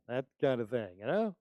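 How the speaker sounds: ordinary talk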